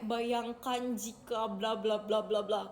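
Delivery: slow, drawn-out mock reflection speech; slow solemn-speech parody trailing into blah-blah; muhasabah speaker. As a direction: imitate a school reflection leader, slowly intoning. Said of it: "kayak muhasabah kalau di sekolah".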